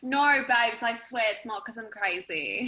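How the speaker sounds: put-on British accent, pleading. Shown as a demonstration. Actress showing off her acting classes, British accent. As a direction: swear it in a playful British accent. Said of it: "Your British accent".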